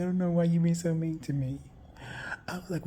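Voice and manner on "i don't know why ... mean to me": higher, imitating a hurt little girl; mock whiny girl voice, pitched up; Christina's crying plea. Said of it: "She's like".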